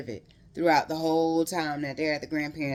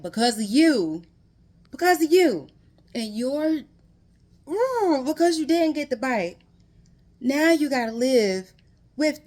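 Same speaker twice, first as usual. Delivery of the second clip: higher, louder scolding of a book character; outraged at character; 8 semitones higher, 6 dB louder than usual. Real, not acted. Reader yelling at the boy in the book, outraged. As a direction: let the frustration burst out. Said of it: "I'm so mad I'm almost in tears".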